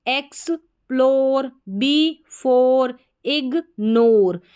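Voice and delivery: "एक्सप्लोर, before, इग्नोर" slowly broken into two parts each; syllable by syllable. Shown as a demonstration. Teacher reads o-r-e words to learners, slowly breaking "एक्सप्लोर, before, इग्नोर" in two. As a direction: split "इग्नोर" into "ig" and "nor" with a gap. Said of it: "हम तोड़ कर पढ़ रहे हैं".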